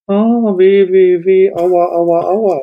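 high loud wailing ouch ouch; whiny ouching. Retold or imitated. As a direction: whimper in exaggerated self-pity. Mocking a whiny complaining leader.

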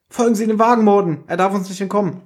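high-pitched, pressing command; urgent order. A narrator's reading. Pinky tells the chauffeur to follow the car, urgent order.

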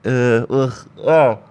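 disgusted grunt after a cold sip; while sipping a drink. Real, not acted. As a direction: groan at the taste of cold coffee. Sip of ice-cold coffee.

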